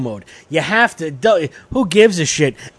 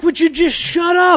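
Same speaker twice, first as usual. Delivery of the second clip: mock yell; 11 semitones higher than usual. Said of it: "You're like".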